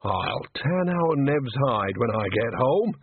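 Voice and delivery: ordinary delivery, nothing marked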